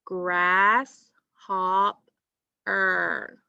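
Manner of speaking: "Grasshopper" very slowly as grass, hop, er; drawn-out "Grasshopper" in three separate beats; syllable by syllable. Shown as a demonstration. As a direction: stretch each syllable of "Grasshopper" with a pause after it. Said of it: "All right, let's try it out".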